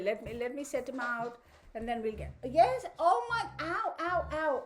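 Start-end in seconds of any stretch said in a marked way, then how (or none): 2.4-4.6 ouch. Real, not acted. Dog stretches paw onto her.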